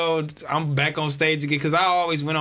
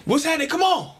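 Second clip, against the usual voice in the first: high, shouted, demanding; angry yell; 9 semitones higher than usual. Retold or imitated. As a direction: storm off the stage demanding the culprit. Angry Wayne voiced in story.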